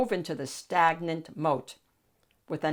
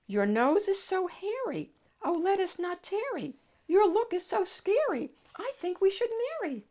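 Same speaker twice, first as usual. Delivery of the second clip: very high, sing-song princess; 13 semitones higher than usual. Acted. Princess rhyming proposal.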